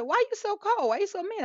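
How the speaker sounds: high-pitched imitation of a rebuffed suitor; mock complaint. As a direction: protest up high, playing the wounded guy. Acts out the man's complaint.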